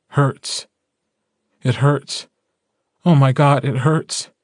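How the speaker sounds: anguished. Acted. Wolf relives pain of loss.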